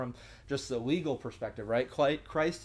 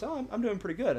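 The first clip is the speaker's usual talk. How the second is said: higher-pitched, pleased self-talk; self-satisfied thought; 9 semitones higher, faster than usual. Retold or imitated. Preacher quoting his first thought about himself, higher and self-satisfied. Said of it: "my first thought was".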